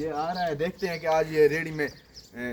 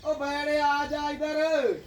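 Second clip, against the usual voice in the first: high, very slow stretched call; drawn-out call; 10 semitones higher, much slower than usual. Real, not acted. Herder calling buffalo from afar, long high call.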